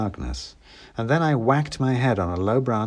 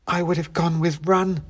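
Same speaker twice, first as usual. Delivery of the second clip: a hint of a sharp girl, higher; light sardonic girl voice; lightly voiced girl; 9 semitones higher than usual. A narrator's reading. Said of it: "said the girl".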